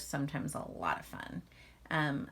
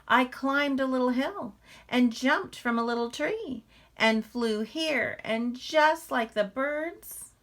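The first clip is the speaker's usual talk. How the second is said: proud little bear; 7 semitones higher, 7 dB louder than usual. Acted. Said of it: "said Little Bear".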